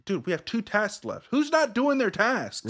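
high-pitched exasperated plea; mock freak-out, rising in pitch; kinda freaking out. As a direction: call out the slackers, voice climbing high. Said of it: "you're, like, kinda freaking out".

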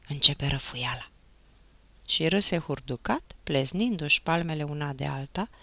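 usual speaking voice

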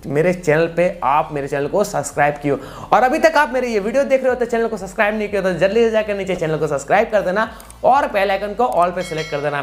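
ordinary talk

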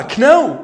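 كناو said with the k sounded, k-now; wrong pronunciation. Shown as a demonstration. Teacher shows students the wrong way, loud.

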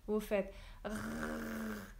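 slow gargled rrrr from the throat; throaty French R. Shown as a demonstration. Teacher repeating the French R for students, slow gargled rrrr. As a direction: drag out rrrr at the back of the throat. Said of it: "vous mettez l'eau".

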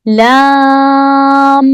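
"lam" sustained at length; held lam. Shown as a demonstration. Teacher reading letter names aloud, lam held long. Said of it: "E, okuyorum".

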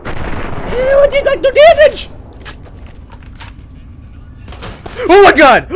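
very high panicked shrieking. Real, not acted. Losing fight, shrieking.

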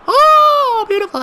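very high, loud ecstatic scream; real scream at a header. Real, not acted. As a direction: let out a joyful shriek. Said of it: "Apologies if I screamed in your ear".

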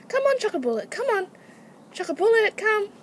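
high, loud coaxing to an animal; calling a pet. Real, not acted. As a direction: urge your pet over with short high calls. Calling guinea pig over.

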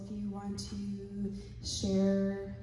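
normal talking voice

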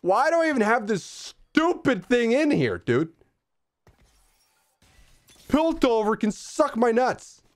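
high, loud frustrated rant; getting aggressive. Real, not acted. Gamer apologizing to viewers while raging. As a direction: vent furious at the game. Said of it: "I'm getting a little aggressive".

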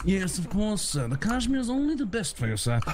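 hammed-up obliging butler; servile butler. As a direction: fuss over your master as a butler. Plays butler offering linen.

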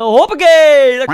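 loud, high, very slow "Hoppakee!"; drawn-out triumphant cheer; excited. Real, not acted. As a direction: stretch it out like a cheer. Celebrating own tackle.

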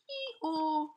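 high imitated hee-haw; donkey bray. Donkey's bray in read-aloud story.

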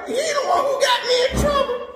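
put-on boy egging mom on to whoop his friend; instigating shout. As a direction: stir up trouble for a friend. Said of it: "I was instigating".

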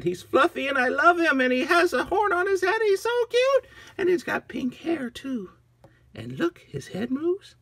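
very high, gushing squeal; thrilled little girl. Acted. Agnes loving her new unicorn, high excited gushing. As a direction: squeal with delight, easing off slightly.

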